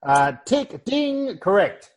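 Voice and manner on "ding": imitated bell ding. Quiz host sound effect.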